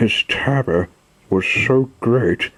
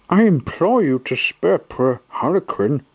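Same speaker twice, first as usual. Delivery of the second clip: put-on higher pleading boy puppet voice; Pinocchio pleading; 7 semitones higher than usual. Pinocchio pleading with the showman for Harlequin. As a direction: implore him for a friend.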